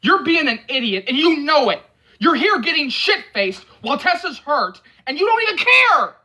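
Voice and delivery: building to a high, loud yell. Acted. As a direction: let it climb until you're yelling. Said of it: "he yells".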